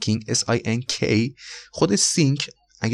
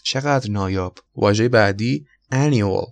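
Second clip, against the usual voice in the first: ordinary delivery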